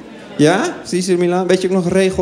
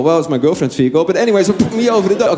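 usual speaking voice

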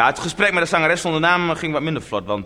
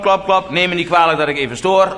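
ordinary delivery

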